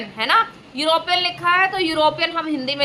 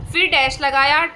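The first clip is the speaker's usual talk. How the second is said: usual speaking voice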